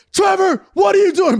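high, loud mock yell; teacher scolding. Retelling a lazy-eyed teacher, high mock yell. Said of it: "he'd be like".

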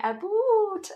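exaggerated Canadian "a boot", high and loud; hammed-up Canadian "oo" in "a boot"; Canadian accent. Shown as a demonstration. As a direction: play up the Canadian vowel in "a boot". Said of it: "That's my accent. So when I say".

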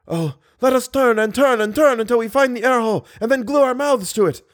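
high, breathless urgent plea; desperate gasp. Acted. Drowning man urging his companion to find air, desperate. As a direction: grasp at the last hope.

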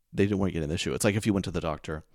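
ordinary delivery

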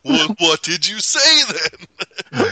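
high, loud, drawn-out demand; mock exasperation. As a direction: stretch the question out, pestering. Acting out exasperated question.

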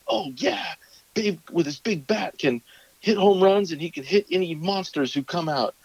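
put-on high, eager little-boy chatter; excited kid voice. Son cheering up about Babe Ruth, high and eager. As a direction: sound like a kid won over by a toy. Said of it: "and he's like".

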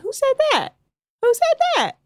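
high sassy housewife voice; imitating a reality TV diva; Real Housewives quote. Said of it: "And she's like".

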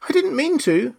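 high-pitched apologetic plea; pleading. A narrator's reading. Alice apologising to the offended mouse, pleading. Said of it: "pleaded poor Alice".

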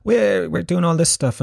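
high mock Liverpool accent; put-on Scouse student voice. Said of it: "put on a fucking Liverpool accent".

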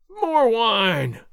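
high, loud, drawn-out bellow; long roaring demand, falling at the end; howl. A narrator's reading. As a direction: howl for drink across the tavern. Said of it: "Gonzalez howled".

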